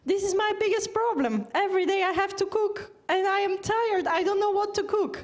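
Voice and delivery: plaintive patient. Retold or imitated. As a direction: mimic the worried patient's whine. Patient complaining about cooking.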